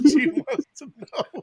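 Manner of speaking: high, slow laughing squeal; breaking up with laughter. Real, not acted. Laughing hard at the joke.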